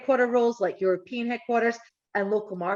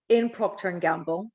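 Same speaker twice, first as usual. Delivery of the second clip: usual speaking voice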